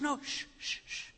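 very soft, soothing reassurance; tender hushing father. Acted. Father hushing his repentant son, tender and soft. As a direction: hush them gently, then reassure quietly. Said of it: "as if the father says".

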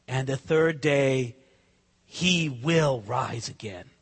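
low, slow, weighty quote; solemn promise. Acted. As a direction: drop low and let each word land slowly.

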